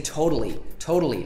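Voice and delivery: "totally" said as toh-dally. Shown as a demonstration. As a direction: soften the middle T of "totally" to a D. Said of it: "In other accents".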